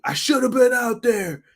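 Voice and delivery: shouting. Retold or imitated. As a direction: yell it out high. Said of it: "Arn yells at him saying".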